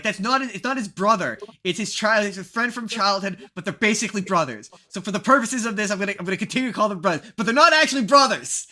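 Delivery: loud, high, exasperated correction, pushing harder to the end; mock exasperated correction. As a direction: correct your friend in hammed-up loud frustration. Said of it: "a bit we just kept doing".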